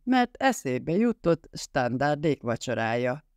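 usual speaking voice

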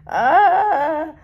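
sudden high cry; high shriek. Real, not acted. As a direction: shriek in shock. Shrieking at the reveal.

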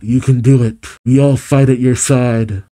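ordinary talk, nothing marked

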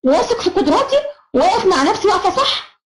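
high, bright mock of a confident child saying it; confident pupil voice. Shown as a demonstration. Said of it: "الثقة بتساوي صدق". (صوت طفل واثق من نفسه بنبرة عالية)

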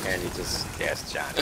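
deflated disappointment. Retold or imitated. Player retells seeing Johnny gassed after getting rolled up, deflated. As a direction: retell it with all the hope gone. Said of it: "the total disappointment in my voice".

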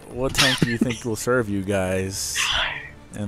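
whispering. Pretend. Teasing whisper to teammates.

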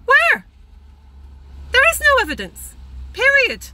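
sharp, loud and high, demanding proof; indignant outburst. Real, not acted. Vlogger to pharmacist who claims proof, indignant loud outburst. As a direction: fling the question back in real indignation. Ranting about homeopathy evidence claim.

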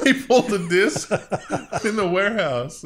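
loud, high, laughing delivery. Real, not acted. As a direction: push the words out through laughter. Joking riff, laughing.

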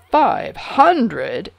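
loud, amazed outburst; exclaiming. A narrator's reading. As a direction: burst out in delighted surprise. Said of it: "exclaimed the king".

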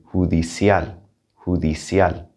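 judicial in Spanish, J said like English H. Shown as a demonstration. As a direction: say judicial as "hoo-dee-see-al". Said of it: "sounds like an H".

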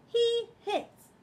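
very high, loud tattling pig; loud high Piggy. Acted. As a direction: tell on him to everyone.

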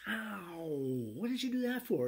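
high, indignant ow; hammed-up hurt son; a son just whacked, protesting; mock pained yelp. Son hit on the head.

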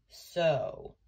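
put-on low old-man voice. A narrator's reading. Old man with ladder taking in the news, low voice. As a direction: drop deep for an old man. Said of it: "said the old man with the ladder".

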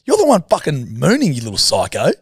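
scolding. Retold or imitated. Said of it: "And I'm like".

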